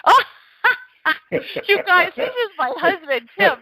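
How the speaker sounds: laughing as she recognizes her husband; high laughing outburst, caught off guard; laughing surprise. Real, not acted. Host laughing on air at her husband's prank call.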